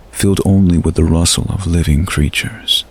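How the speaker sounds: ordinary delivery, nothing marked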